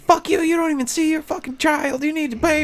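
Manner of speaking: high mock scream of a trashy woman. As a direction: imitate her yelling on the phone. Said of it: "screaming at the top of her lungs".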